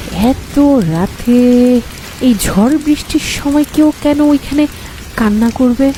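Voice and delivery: plain reading voice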